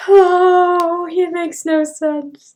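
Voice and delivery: high, loud "Oh", then a slow, drawn-out complaint; exasperated. Real, not acted. Host reacting to Cody's words, loud and exasperated. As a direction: give up on his logic.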